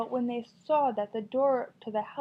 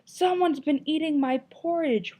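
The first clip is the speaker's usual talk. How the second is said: higher, louder storybook roar; mock roar; 6 semitones higher, 4 dB louder than usual. Papa bear finds his porridge eaten, hammed-up roar. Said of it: "roared Papa Bear".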